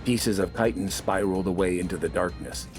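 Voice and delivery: ordinary delivery, nothing marked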